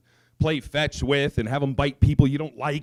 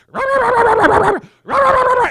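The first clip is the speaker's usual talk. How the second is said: high, loud rapid-fire yapping; imitated dog barking; 8 dB louder than usual. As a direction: yap like a small dog going off at nothing. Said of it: "And all you hear is".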